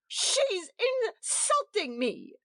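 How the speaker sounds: high, slow, wailing; a crying wife telling the host she's being insulted; crying complaint. A narrator's reading. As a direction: drag it out through tears. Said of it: "exclaimed Edith brokenly".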